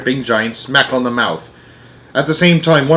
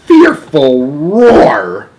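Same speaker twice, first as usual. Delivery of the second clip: loud, drawn-out rise on the roar; dramatic growling emphasis; 4 semitones higher, 5 dB louder, much slower than usual. A narrator's reading. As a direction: make the words roar. Acts out the roar in narration.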